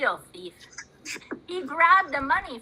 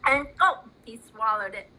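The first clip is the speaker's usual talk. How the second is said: ordinary delivery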